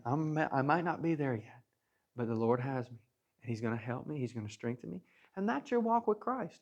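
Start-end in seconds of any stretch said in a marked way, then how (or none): 0.0-5.0 quiet prayer. Retold or imitated. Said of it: "okay with saying".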